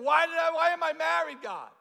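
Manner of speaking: high, loud wail of comic despair; mock anguished shout. Married churchgoer complaining to God, mock anguished shout. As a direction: cry out to God in hammed-up agony. Voicing an exasperated married person.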